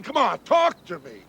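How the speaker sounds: ordinary delivery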